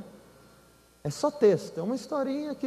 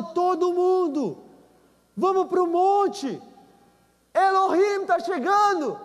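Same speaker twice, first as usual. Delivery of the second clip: calling out; 9 semitones higher, slower than usual. Retold or imitated. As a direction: call the crowd to the mountain. Moses calling the people. (chamando o povo em voz alta)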